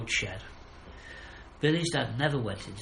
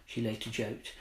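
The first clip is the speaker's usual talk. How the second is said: plain reading voice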